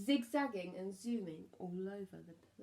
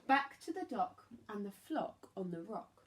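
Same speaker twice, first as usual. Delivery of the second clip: ordinary delivery, nothing marked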